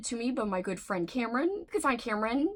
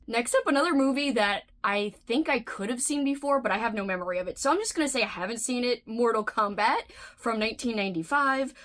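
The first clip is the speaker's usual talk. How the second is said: ordinary talk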